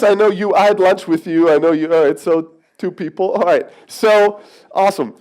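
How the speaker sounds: ordinary talk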